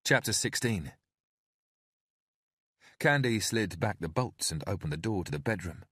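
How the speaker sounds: plain reading voice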